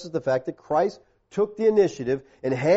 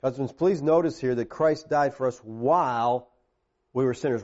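ordinary talk, nothing marked

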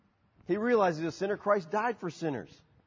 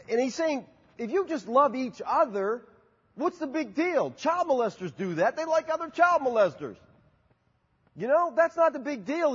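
raised, driving sermon voice; preaching with force; 7 semitones higher than usual. Acted. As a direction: press the point home to the congregation.

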